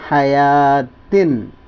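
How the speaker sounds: हयातिन drawn out, low and long; slow recitation. Shown as a demonstration. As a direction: stretch हयातिन slowly for the class. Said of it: "तो वो लिखा हुआ है". (हयातिन को धीमे और लंबा पढ़ना)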